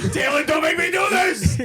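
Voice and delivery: high, loud hammed-up action-movie plea; mock dramatic threat. Joking about dad chasing with a shotgun, hammed-up shout. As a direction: ham it up like a desperate hero.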